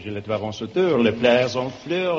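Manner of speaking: dreamy put-on French patter; French accent. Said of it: "It is La Belle France".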